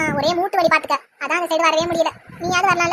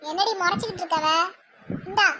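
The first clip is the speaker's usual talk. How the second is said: usual speaking voice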